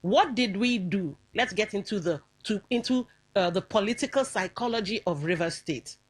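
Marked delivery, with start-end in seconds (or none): none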